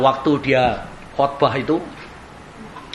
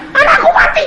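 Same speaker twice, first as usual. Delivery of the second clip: high, loud wail of a mother; desperate shout; 7 dB louder than usual. Retold or imitated. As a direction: cry it out to the preacher. (teriakan ibu, tinggi dan keras)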